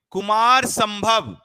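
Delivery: slow, loud, high title call; loud announcement. Shown as a demonstration. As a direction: announce the book name slowly. Announces next title loudly.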